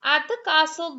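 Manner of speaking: ordinary delivery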